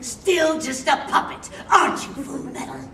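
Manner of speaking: loud, high, drawn-out taunting delivery; big theatrical puppet-show taunt; character quote performance. Shown as a demonstration. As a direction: belt it out slowly like a dramatic anime line. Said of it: "Tell me what to say and I'll do it".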